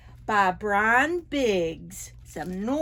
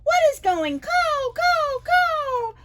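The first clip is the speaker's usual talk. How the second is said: high crow voice; 12 semitones higher than usual. Acted.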